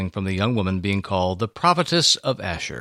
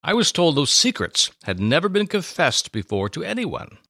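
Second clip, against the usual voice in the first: a touch higher, puzzled question; lightly voiced Simeon; 7 semitones higher than usual. Priest Simeon asking Anna how she knows, mildly amazed. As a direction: ask her gently amazed. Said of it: "Anna would reply".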